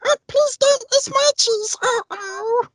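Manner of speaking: very high squeaky mouse voice; cartoon mouse voice. Shown as a demonstration. Voice actor demos a full caricature mouse pleading for his cheese, squeaky mouse voice. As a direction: squeak like a tiny scared mouse. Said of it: "go on full caricature as though, "Yeah, I'm a mouse".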